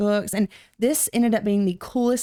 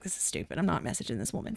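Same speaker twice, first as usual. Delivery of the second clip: whispered thought; 4 semitones lower, 11 dB quieter, faster than usual. Retold or imitated. Retold private thought about messaging.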